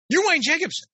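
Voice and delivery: high, loud, startled gasp of recognition. Retold or imitated. Woman at dinner recognizing the author, startled high cry. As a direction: blurt it out in shock.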